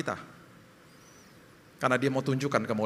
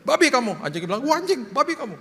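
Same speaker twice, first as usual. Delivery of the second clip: mock dog voice; 8 semitones higher than usual. Mock dog insulting boss.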